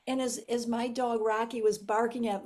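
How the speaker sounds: ordinary delivery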